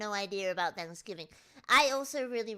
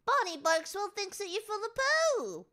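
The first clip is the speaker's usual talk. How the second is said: high-pitched playful put-down; mock scolding; 10 semitones higher than usual. Teasing men who love Die Hard, high mock scolding. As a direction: tell them off in a high, silly voice.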